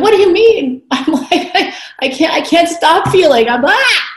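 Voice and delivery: imitated overwhelm. Speaker replaying herself overwhelmed to her therapist, high and frantic. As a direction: blurt it out high and helpless, spilling over.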